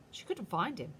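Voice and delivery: ordinary delivery, nothing marked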